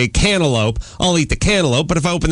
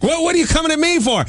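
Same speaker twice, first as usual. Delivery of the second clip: high, fast, defensive retort; angry wife; faster than usual. Retold or imitated. Wife fighting back at her nagging husband, angry. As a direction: imitate an angry wife, quick and high-pitched. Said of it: "Now she's angry".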